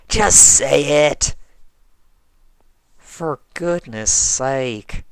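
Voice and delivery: slow, exasperated Southern grumble; grumbling. Acted. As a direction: drag it out, fed up. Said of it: "I grumbled".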